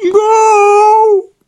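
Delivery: a shrieking, very high, very loud scream; terrible scream. A narrator's reading. Giraffe screams as the ceiling falls, shrieking. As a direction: scream in sudden terror. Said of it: "ein fürchterlicher Schrei".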